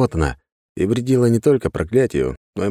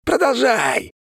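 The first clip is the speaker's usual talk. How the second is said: much higher shouting monster; screaming curse; much slower than usual. A narrator's reading. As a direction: taunt like a crazed demon. The curse keeps taunting.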